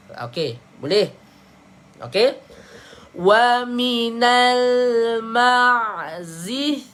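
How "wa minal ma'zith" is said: "ma'zith" drawn out, th ending; slow recitation. Shown as a demonstration. Teacher modelling the correct verse ending. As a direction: recite slowly, stretching each syllable. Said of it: "Ha, macam tu".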